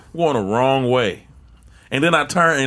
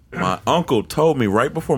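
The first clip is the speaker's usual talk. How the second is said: ordinary delivery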